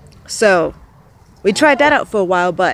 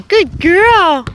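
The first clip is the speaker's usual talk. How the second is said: slow praise; 9 semitones higher, 4 dB louder, slower than usual. Real, not acted. Praising goose.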